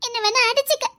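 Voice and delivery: normal talking voice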